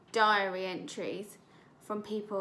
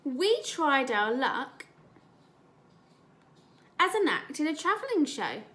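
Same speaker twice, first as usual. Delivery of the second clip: slow, drawn-out storybook voice; story reading; 2 semitones higher, 4 dB louder, slower than usual. Acted. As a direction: savour each line for the class. Teacher reads story aloud.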